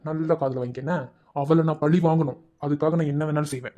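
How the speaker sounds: normal talking voice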